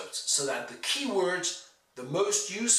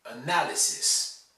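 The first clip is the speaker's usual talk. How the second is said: 'analysis' as a-NAL-ysis; 'analysis' with weak first vowel, strong second syllable; stressed second syllable. Shown as a demonstration. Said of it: "You must pronounce".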